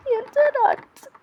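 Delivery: high, hammed-up wounded cry; dramatic outburst. A hammed-up protest at her sisters, high and theatrical. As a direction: overact being hurt and ignored. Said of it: "Ay, çok değerli. Özür dileriz".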